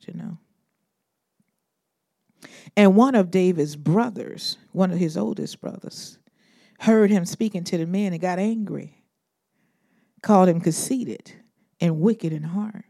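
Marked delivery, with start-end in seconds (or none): none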